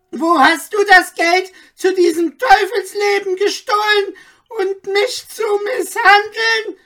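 very high, loud screaming rant, near tears; enraged tirade. A narrator's reading. The magistrate raging at Anton, a very high screaming tirade. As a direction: shriek every threat.

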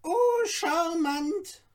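high, slow, delighted little dwarf exclaiming; delighted cry. A narrator's reading. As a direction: relish the word like a charmed dwarf. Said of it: "rief das Zwerglein".

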